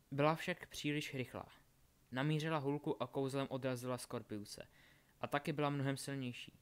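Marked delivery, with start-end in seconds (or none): none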